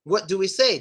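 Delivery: ordinary talk, nothing marked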